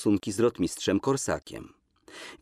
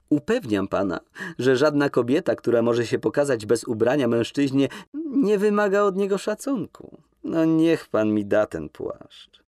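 higher, teasing feminine voice; lightly voiced young woman; 6 semitones higher, 3 dB louder than usual. Woman in the bath teasing a shy man, playful higher voice. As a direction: toy with him, amused and higher.

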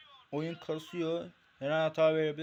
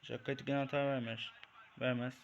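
ordinary talk, nothing marked

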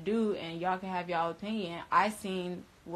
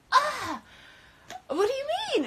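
much higher, loud near-crying cry; distressed disbelief; 5 dB louder than usual. Retold or imitated. Answering roommate who won't leave, distressed and much higher.

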